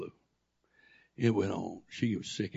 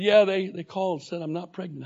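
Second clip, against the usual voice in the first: wife's answer; 8 semitones higher, 9 dB louder than usual. Retold or imitated. Wife retold, louder and higher.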